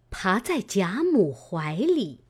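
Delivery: usual speaking voice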